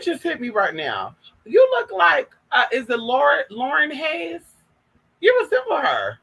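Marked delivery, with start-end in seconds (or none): 1.4-4.4 pitched way up and louder; sudden high squeal of recognition; bursting with excitement at the lookalike; excited realisation. Real, not acted.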